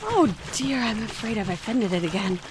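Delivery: soft, dismayed murmur; hushed regret; worried. Acted. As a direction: fret quietly to yourself. Mouse swims away.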